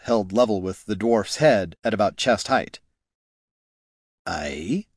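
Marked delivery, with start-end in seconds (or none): 4.3-4.9 low, soft and pointed; softly. A narrator's reading. Gorm answers the warrior's height remark softly, low. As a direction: say it quiet and dangerous. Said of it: "Gorm said softly".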